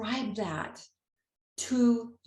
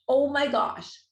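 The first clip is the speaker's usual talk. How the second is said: amazed; 5 semitones higher, 5 dB louder, slower than usual. Real, not acted. Revealing the plate's message.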